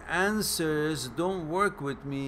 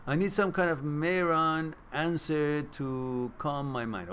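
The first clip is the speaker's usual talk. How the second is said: usual speaking voice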